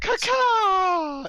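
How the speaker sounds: silly cartoon cry. Silly Simpsons Australia voices.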